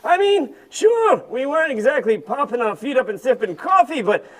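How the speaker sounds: put-on tired, complaining old camel voice; grumpy old camel. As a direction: moan about aching back and feet. Camel grumbles about the desert.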